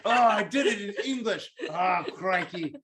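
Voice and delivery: hammy mock-Australian dismay, slowing down; Australian accent. Robber realises he slipped into English, mock-Australian accent. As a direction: groan it as a rueful Australian. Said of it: "every time I do my Australian voice".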